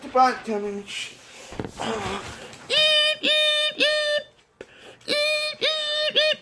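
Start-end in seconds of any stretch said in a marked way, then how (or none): 2.7-6.4 very high, thin sung beeps; smoke detector beep. Retold or imitated. Toy's button plays the smoke detector, high beeping. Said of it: "Yep, the smoke detector sound".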